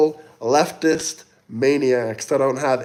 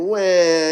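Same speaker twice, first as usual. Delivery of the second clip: mock baby crying; 4 semitones higher than usual. Mocking crying.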